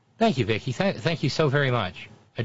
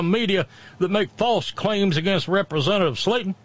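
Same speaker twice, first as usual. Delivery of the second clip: high-pitched, hammed-up lawyer reading a statement; mock a pompous attorney's official denial; put-on pettifogger voice, pitched well up; Patrick Short; 7 semitones higher than usual. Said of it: "pettifogger by the name of Patrick Short".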